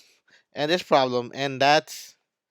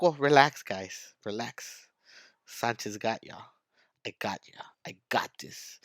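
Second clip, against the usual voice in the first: calm reassurance; 4 semitones lower, 6 dB quieter than usual. Acted. Teacher calms class after panic.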